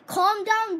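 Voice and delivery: ordinary delivery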